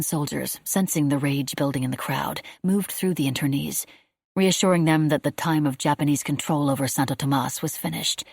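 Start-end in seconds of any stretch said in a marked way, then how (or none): none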